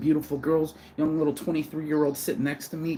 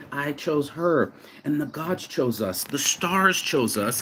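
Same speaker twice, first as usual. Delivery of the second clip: slow solemn; 3 semitones higher, slower than usual. Real, not acted. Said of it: "I'm emotional".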